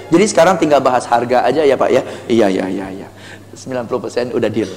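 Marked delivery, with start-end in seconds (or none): 2.2-3.1 passive customer agreeing. Acted. Customer nodding along.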